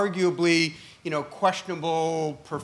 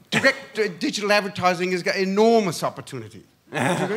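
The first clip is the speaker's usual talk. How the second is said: faster, nasal British accent; 3 semitones higher, 4 dB louder, faster than usual. Shown as a demonstration. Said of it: "Perhaps I could talk in a British, somewhat nasally voice".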